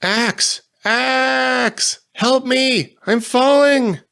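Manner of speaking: very high, loud cries for help; desperate screams. A narrator's reading. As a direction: scream for help while falling. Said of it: "and I screamed".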